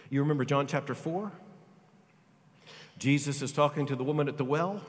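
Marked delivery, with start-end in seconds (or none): none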